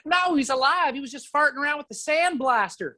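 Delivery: high Georgian lady voice; Georgian accent. Retold or imitated. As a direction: play the Georgian lady explaining, pitched well up. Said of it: "like, Georgian accent".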